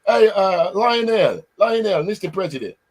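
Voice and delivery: put-on over-the-top Jamaican accent caller; fake Jamaican accent. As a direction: call out in a hammed-up Jamaican accent. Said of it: "my fake Jamaican, uh, accent".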